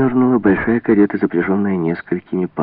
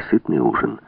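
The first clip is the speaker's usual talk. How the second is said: ordinary delivery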